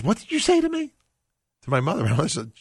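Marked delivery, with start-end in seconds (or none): 0.0-0.9 high, startled cry of a confused husband; startled question. Retold or imitated. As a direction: blurt it out high and bewildered. Father startled in retold story.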